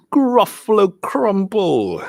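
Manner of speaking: high, drawn-out storybook threat; menacing mouse. Acted. Mouse threatening the Gruffalo with its favorite food, slow menace.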